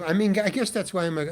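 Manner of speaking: usual speaking voice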